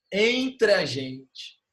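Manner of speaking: "Entre a gente" slowly, with "Entre a" merged; vogais juntas, devagar. Shown as a demonstration. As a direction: draw out "Entre a gente" but link the vowels. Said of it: "Eu junto esse A com entre". ("Entre a gente" bem devagar, vogais emendadas)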